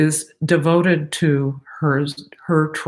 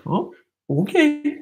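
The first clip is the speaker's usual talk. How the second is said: high, slow voice for the dog; a dog answering meekly in a high voice; dog's voice; 10 semitones higher than usual. Retold or imitated. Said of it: "Stella's like".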